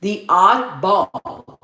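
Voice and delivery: ODDBALL drawn out very slowly and deliberately; careful re-attempt. Real, not acted. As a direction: stretch out "oddball", sounding out each half. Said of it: "I can't even talk. I need my retainer".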